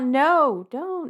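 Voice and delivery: high, loud mock cry of protest; retold yell. Girl stopping a man whipping horse, mock yell. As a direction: cry out as if stopping someone, acted. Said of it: "Felicity yells out".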